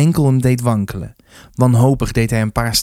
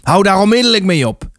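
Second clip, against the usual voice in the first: high, raised, commanding order; whip-crack voice; 7 semitones higher, 4 dB louder than usual. A narrator's reading. Rector to fighting boys, whip-crack command. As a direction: cut through the fight with a sharp command. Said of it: "zijn stem trof hen als een zweepslag".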